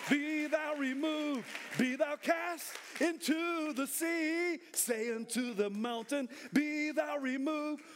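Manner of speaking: high, drawn-out preacher's shout; full-force preaching. Real, not acted. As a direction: preach it to the rafters, stretching the phrases.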